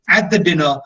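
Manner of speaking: usual speaking voice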